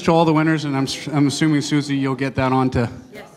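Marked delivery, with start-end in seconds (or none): none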